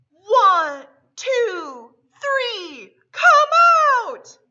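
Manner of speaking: loud, rising count to a shout; crowd countdown. A narrator's reading. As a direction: count down then burst out. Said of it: "The crowd yelled".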